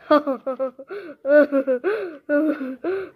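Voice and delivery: long staged villain laugh; hammed-up evil laughter; gloating laugh. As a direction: laugh on and on in mock triumph. Gloating after getting the snack.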